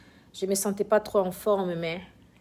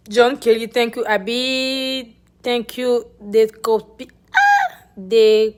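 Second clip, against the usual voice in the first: excited; 3 semitones higher, 10 dB louder than usual. Real, not acted. Loud shout-outs to viewers.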